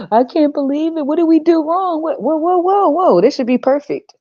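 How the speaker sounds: high-pitched imitation of a distraught parent; mock distraught parent. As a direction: mimic parents in disbelief, voice shooting up high. Mocks parents' shocked reaction to their child.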